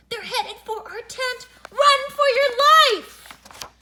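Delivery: high alarm building to a drawn-out scream; panicked yell. A narrator's reading. Boys fleeing lights headed for their tent, panicked yell. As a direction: yell a frantic warning.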